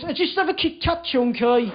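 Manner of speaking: imitated Birmingham flatmate; Birmingham accent. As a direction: give in with broad Birmingham vowels. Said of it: "in his Birmingham accent".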